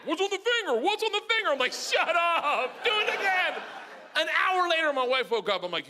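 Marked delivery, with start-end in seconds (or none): 0.0-1.5 high, excited dog character; eager dog voice. Retold or imitated. Voices the eager dog coming back. 1.7-3.5 high-pitched comic urging; hammed-up eager demand. Acts out himself egging them on.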